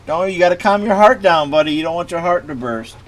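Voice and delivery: high coaxing voice to a dog; calm the frightened pup; soothing a scared dog. Real, not acted.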